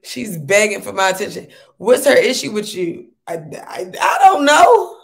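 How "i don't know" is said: loud, high, crying-out I don't know; exasperated shout. Real, not acted. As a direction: throw up your hands and yell it. Asked about Leah's issue.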